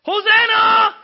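screaming high and loud in terror; panicked cry for help. Retold or imitated. Boy pulled out by rip current screams for help. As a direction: yell it like you're drowning in a rip. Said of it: "because I'm petrified".

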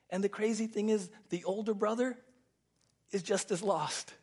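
normal talking voice

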